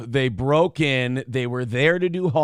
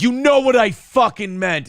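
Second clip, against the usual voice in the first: loud, higher exasperated outburst; raised-voice, fed-up retort; retold yelling match; 7 semitones higher, 5 dB louder than usual. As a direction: snap back as if mid-argument. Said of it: "you can have that yelling match".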